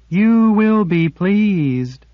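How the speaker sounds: high, loud, very slow delight; eager to please. A narrator's reading. Sliff welcoming them, eager. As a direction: beam with eagerness. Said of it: "eager to satisfy".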